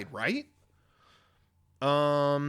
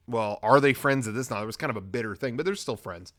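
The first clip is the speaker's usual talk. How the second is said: normal talking voice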